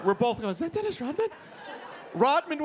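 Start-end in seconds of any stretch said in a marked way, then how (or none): 0.5-1.3 high, hushed star-struck question; mock amazement. Brawlers spotting the NBA legend, amazed. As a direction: ask it in high-pitched wonder. Two men stunned by celebrity's entrance.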